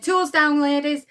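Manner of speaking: rallying the women to help Alice, called out loud; calling out. Retold or imitated. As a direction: shout across the factory floor to stop work.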